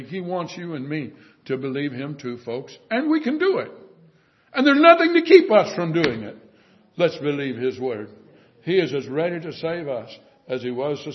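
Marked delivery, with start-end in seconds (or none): 2.9-6.4 loud, high rallying cry; fervent preaching. Acted. Preacher rallying the congregation to believe, loud fervent preaching. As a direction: lift the congregation up with conviction.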